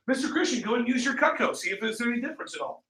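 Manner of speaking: high, soft and limp; unenthusiastic salesman. Shown as a demonstration. Trainer showing reps how not to demo, unenthusiastic. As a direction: run the demo with no conviction. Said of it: "Can you imagine if this is how it went?".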